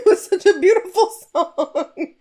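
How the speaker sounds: laughing through it, lifted up high. Real, not acted. Recalling the lovely choir song, laughing. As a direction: gush about the song while cracking up.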